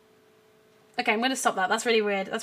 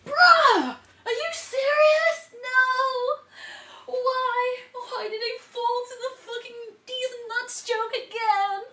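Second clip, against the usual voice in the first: comic despair; 9 semitones higher than usual. Real, not acted. Streamer lamenting that she fell for the joke, whiny despair. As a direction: wail like a sore loser.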